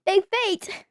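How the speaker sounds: put-on a British accent that slides out of shape; bad British accent. Son insults sister in a failing British accent. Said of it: "That's not even an accent anymore".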